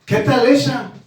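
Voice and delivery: ordinary talk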